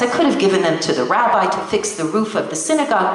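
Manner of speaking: usual speaking voice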